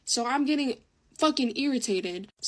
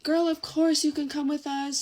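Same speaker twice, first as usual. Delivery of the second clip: imitated cheerleaders; 5 semitones higher than usual. Voicing the cheerleaders' friendly reply.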